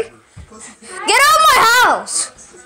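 slow, high, shouted order; furious shout. Acted. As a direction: drag out every word of the eviction. Owner throws intruder out.

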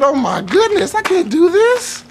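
high, rising panicked whine. Retold or imitated. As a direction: wail it in mock panic. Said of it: "I said".

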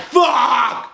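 high, drawn-out exasperated cry; acted-out groaning shout of frustration; retold frustrated yell. Acting out waking up locked up.

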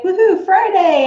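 mock cheer. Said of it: "I could swear it's like".

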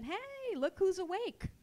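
high, mock-hearty father's hello; lightly acted teasing dad; father's greeting. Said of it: "My father saw me and said".

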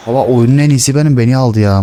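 normal talking voice

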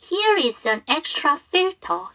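slow, carefully linked model sentence; teacher's unhurried read with "is an" joined; slow demonstration. Shown as a demonstration. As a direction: say it slowly for learners to copy. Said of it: "천천히 다시 한번 해보면".

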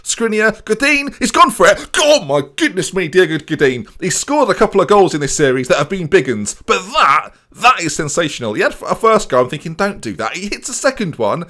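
normal talking voice

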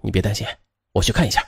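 low, soft word of comfort; calm a nervous partner, voice dropped and hushed; quiet, low reassurance; reassuring. A narrator's reading. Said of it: "徐海峰连忙安慰他".

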